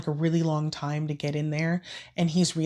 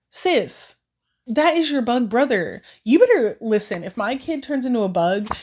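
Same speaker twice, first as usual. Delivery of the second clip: indignant scolding; 5 semitones higher, 4 dB louder than usual. Real, not acted. Scolding the sister in the story.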